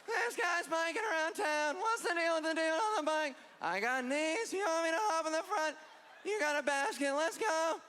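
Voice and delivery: high, nasal-style Seinfeld observational whine; Seinfeld impression. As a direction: do a Seinfeld 'what's the deal' bit. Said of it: "I sounded like Seinfeld".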